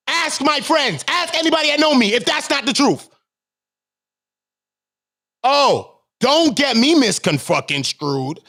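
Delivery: high-pitched indignant protest; real exasperated outburst; heated self-defence. Real, not acted. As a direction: protest your reputation with rising heat. Heated self-defence against accusations.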